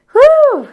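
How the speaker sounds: loud, high release of breath; a big, high, emotional whew. Real, not acted. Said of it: "It's an emotional moment".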